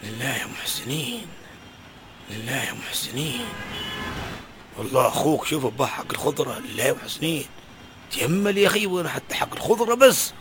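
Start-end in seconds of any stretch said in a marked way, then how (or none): 0.0-3.5 low soft rising plea for alms; begging call. Acted. Beggar calling to shoppers for alms, soft rising plea. 4.8-7.4 low, soft begging drone; beggar's plea. Acted. Poor man begging passers-by in the market, low pleading voice.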